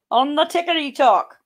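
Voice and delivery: sing-song mock name. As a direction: tease the word like a silly nickname. Mocking TikTok name.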